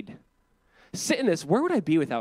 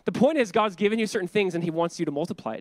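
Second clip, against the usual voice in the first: normal talking voice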